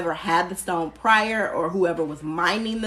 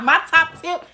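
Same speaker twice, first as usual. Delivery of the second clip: high, loud and amused; laughing; 10 semitones higher, 6 dB louder than usual. Real, not acted. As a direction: say it through leftover laughter. Laughing after her sung-out answer.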